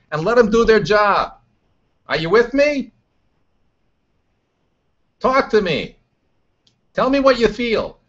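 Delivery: put-on campaign-speech bluster in a New Jersey accent; Giuliani impression. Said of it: "your New York accent is really bad".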